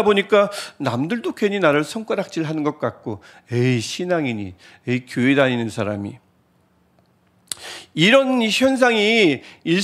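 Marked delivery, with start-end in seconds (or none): 3.5-6.2 low, dismissive imagined reproach; scornful tut. Retold or imitated. Others judging a struggling believer, low scornful reproach. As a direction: mutter it as a disapproving onlooker. Others pointing fingers, disapproving.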